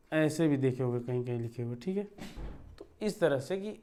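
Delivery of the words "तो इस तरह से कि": normal talking voice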